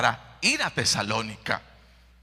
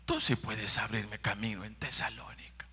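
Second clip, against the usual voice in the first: whispered prayer; 3 semitones lower, 7 dB quieter than usual. Acted.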